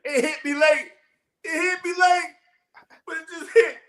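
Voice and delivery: high voice through a laugh; real delayed laughter. Real, not acted. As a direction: squeal it out, cracking up at the joke. Laughs late at the joke.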